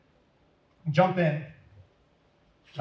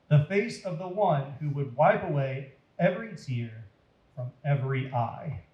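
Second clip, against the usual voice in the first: plain reading voice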